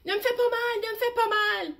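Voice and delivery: a scared lion begging not to be hurt; frightened whimper. Acted. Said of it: "gémit-il".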